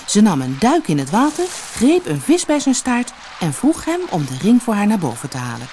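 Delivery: ordinary delivery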